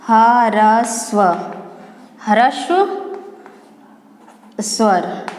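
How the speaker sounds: ह्रस्व स्वर said slowly, syllable by syllable; teacher pronouncing ह्रस्व स्वर carefully; slow spelled-out word. Shown as a demonstration. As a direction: draw out ह्रस्व स्वर for the class. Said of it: "पहले ह्रस्व स्वर में".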